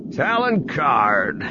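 slow, drawn-out hearty call; a host calling out a welcome to his guest; booming voice. A narrator's reading. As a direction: boom the name across the bridge. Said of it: "Booster's voice boomed".

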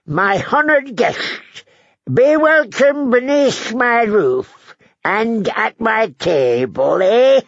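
put-on very high, drawn-out old man voice; cackling old lord. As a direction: make a grand welcome, ending in a wheezy chuckle.

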